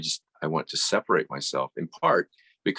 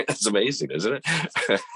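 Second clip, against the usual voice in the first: laughing; 8 semitones higher than usual. Real, not acted. Laughing at client's remark.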